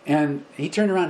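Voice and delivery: normal talking voice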